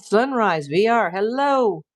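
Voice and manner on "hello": normal talking voice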